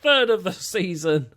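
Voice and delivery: loud, high, excited match call; play-by-play, rising to a big goal shout; goal commentary. Acted. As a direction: call the goal like a commentator, stretching the scorer's name.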